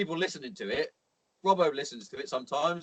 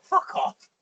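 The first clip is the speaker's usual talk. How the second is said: high-pitched mock incredulous reaction; retold disbelief; 4 dB louder than usual. Mate recounting his reply to a text brag, mock disbelief. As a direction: scoff in exaggerated, squeaky disbelief.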